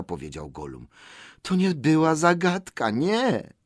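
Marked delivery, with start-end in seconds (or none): 1.4-3.5 high creature voice, insistent; protesting Gollum. Acted. Gollum denies it was a riddle, whining insistently.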